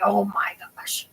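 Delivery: whispered oh my gosh; under-the-breath dismay at a mistake. Retold or imitated. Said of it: "And I was just like".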